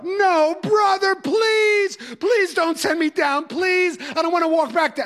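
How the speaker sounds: high, pleading, half-sobbed begging; imitating a freshman wailing to his teacher; wailing plea. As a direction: beg like a scared kid who can't face the stairs. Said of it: "And the wailing would start".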